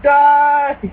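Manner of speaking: high, loud shout; battle yell. Retold or imitated. Gamer screaming at a monster that won't die.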